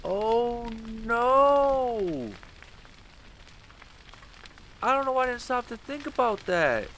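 high frantic screaming; panicked yelling. Real, not acted. Running through fire in game.

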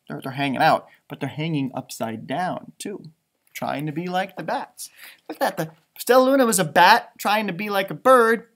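ordinary delivery

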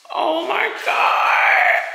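high, drawn-out tearful cry; overwhelmed wail. Real, not acted. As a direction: let it stretch out in heartbroken awe. Overwhelmed seeing lost kitchen.

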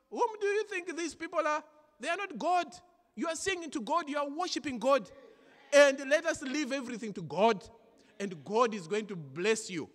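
high, loud preacher's exhortation; preaching at full force. Acted. Pastor exhorting the congregation, loud and high. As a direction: drive the message home to the church.

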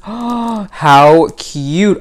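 normal talking voice

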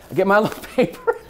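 breaking up laughing mid-line; real laughter spilling into the words; giggling through it. Real, not acted. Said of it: "Don't make me laugh".

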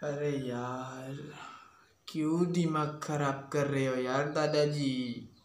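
low, very soft, slow and dragged out; weary exasperation. Acted. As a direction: plead wearily, at the end of your patience. Defeated by the nagging grandfather. (धीमी, हारी हुई झुंझलाहट)

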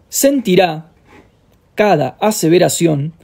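reading through a choke; choked throat while reading; choking. Real, not acted. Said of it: "perdón, me atraganté".

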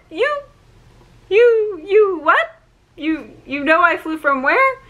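high, stammering disbelief; stunned disbelief. Retold or imitated. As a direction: stammer it out, can't believe she knew. Stunned Taylor knew Virginia.

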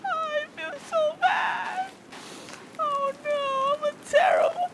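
very high, squeaky crying voice; tearful squeal. Pretend. Player mourning a turtle killed for nothing, high squeaky crying. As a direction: wail in a tiny, high-pitched sobbing whine. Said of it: "I'm actually tearing up right now".

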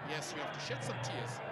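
ordinary talk

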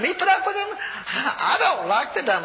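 high, loud, laughing disbelief; can't believe he's asked that; incredulous protest. Retold or imitated. Preacher protesting to God, laughing in disbelief.

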